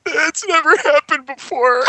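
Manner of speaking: high, slow embarrassed guy voice; mock sheepish excuse. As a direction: whine it like a caught-out lover.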